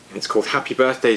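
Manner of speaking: plain reading voice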